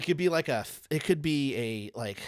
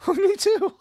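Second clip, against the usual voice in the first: much higher, louder, laughing squeal; squeaky laughing agreement; 11 dB louder than usual. Retold or imitated. Agreeing with his friend, a high laughing squeak.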